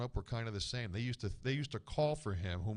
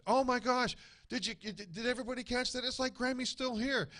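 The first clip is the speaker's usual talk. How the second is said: a cousin amazed by the voice, much higher and excited; 12 semitones higher than usual. Retold or imitated. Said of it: "she said".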